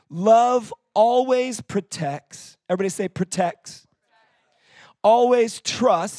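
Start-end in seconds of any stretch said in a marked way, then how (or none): 0.1-2.6 slow, higher and louder proclamation; a preacher thundering scripture to the church; preaching at full force. Acted. 5.0-6.2 slow, higher and louder proclamation; a preacher thundering scripture to the church; preaching at full force. Acted.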